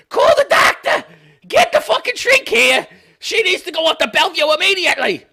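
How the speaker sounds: frantic, high shouted relative in a badly faked accent; alarmed yell, hammed-up accent that misses Australian; botched accent. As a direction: shriek for help in the wrong accent. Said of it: "I got the accent wrong 'cause it's Australian".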